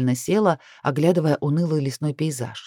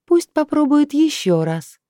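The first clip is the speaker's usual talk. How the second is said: slow, high, mocking inner demon; Lilith's voice, much higher and louder; 10 semitones higher, 4 dB louder, slower than usual. Lilith taunting Kai from inside, high and mocking. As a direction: stretch it out high like a gleeful spirit. Lilith speaks through her.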